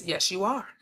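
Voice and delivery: a hushed inner voice saying yes; reply gently and low as consciousness; quietly affirming, barely above a murmur; soft quiet answer. Pretend.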